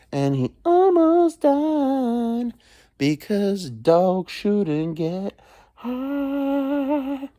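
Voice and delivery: stretched very slow and high; slow, high, drawn-out storytelling. Real, not acted. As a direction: drag each word out for drama. Dragged-out dramatic telling.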